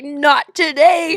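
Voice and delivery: loud, high, drawn-out mock battle cry; defiant cry. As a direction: call it out slowly, refusing to let it go. Retelling her chase after the thief.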